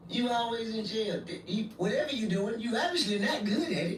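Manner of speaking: high-pitched exasperated mother voice; mama's scolding. Retold or imitated. Mama to her jailed son, high exasperated scolding. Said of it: "my mama said the same".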